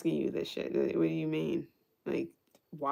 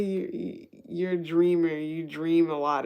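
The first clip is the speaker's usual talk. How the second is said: mock New York; 4 dB louder than usual. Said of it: "what she say?".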